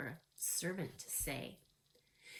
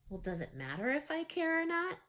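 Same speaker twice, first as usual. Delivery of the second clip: blunt retort, higher and flat-out; 8 semitones higher than usual. A narrator's reading. Mary answering the housekeeper back, blunt. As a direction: toss the question back without caring. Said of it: "said Mary bluntly".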